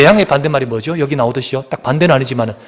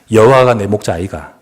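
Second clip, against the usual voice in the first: 경상도 사투리로 가볍게 흉내; lightly mimicked Gyeongsang accent; Gyeongsang dialect; 2 semitones lower, 5 dB louder than usual. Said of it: "경상도 사람은 이렇게 고백합니다". (경상도 사투리 흉내)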